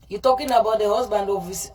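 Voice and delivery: usual speaking voice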